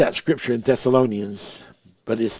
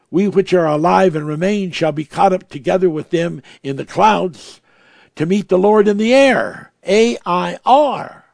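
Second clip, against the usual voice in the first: rising preaching; 4 semitones higher, 5 dB louder than usual. Real, not acted. Preacher driving home the word air, raised. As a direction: build to a shout and spell A I R.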